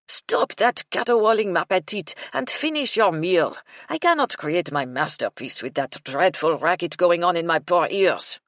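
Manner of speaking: put-on loud, theatrical French-accented outburst; exasperated French chef. French chef silences the howling maid, exasperated French accent. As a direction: rail indignantly in a French accent.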